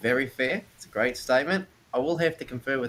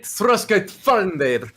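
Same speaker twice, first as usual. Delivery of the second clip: slow, raised, louder Giant phrase; speaking Giant; 5 semitones higher, 5 dB louder, much slower than usual. Acted. Arthur answers him in Giant, slow and emphatic. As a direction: draw out the Giant words loudly.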